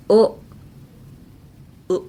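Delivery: short "O" alone, second half of aisle; isolated sound. Shown as a demonstration. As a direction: pop out a lone rounded "O". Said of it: "the mouth you make when you say that".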